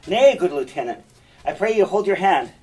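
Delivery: urgent stage appeal; Montano intervening. Acted. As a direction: step between fighters and urge restraint. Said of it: "and Montano intervenes in between them".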